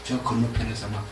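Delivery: hushed, scheming advice in the ear; whispered scheming. Acted. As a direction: lean in and whisper the plot. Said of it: "귓속말로".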